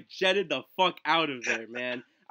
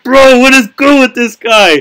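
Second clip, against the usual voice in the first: loud, high, drawn-out disbelief; exasperated outburst; 8 semitones higher, 18 dB louder, slower than usual. Acted. Student reacting to the substitute's nonstop staring, loudly exasperated. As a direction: cry it out slowly, baffled and fed up.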